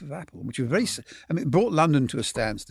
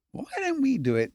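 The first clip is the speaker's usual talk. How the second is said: half-hearted Liverpool impression. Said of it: "I mean, I can't do his accent".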